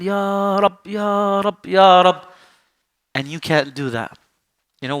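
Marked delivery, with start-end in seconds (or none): 0.0-2.3 drawn-out, raised call to God; sustained, almost chanted plea; imitated pleading dua. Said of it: "doing dua, raising his hand".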